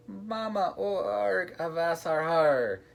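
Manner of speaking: put-on very high toddler pirate voice; whooping and hooting. A narrator's reading. Little Treasure seeing his mom, hooting. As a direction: hoot it with glee, drawing out the end. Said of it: "he let out a whoop and hooted".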